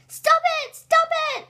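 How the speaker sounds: high, loud squealed protest; teacher begging to stop. A narrator's reading. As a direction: cry out as a helplessly tickled teacher, voice shooting up. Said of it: "laughing out loud and saying".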